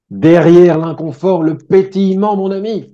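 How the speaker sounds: high, slow sage voice; mock wise guru. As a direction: pronounce it like a guru's maxim. Sage pronouncement, much higher. (gourou moqueur, voix haute et lente)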